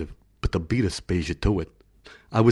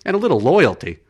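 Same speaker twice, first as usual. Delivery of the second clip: nasty; 5 semitones higher, 6 dB louder than usual. Acted. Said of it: "Aspasia said nastily".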